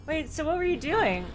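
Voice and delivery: normal talking voice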